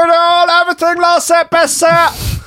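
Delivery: high, loud coaching call; gym trainer voice. Retold or imitated. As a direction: pump up the class. Voicing trainer encouragement.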